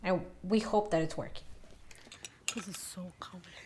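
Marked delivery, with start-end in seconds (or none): 2.5-3.6 whispered grumble; hushed aside. Real, not acted. Vlogger fussing with the mic setup, hushed whisper. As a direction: whisper it under your breath.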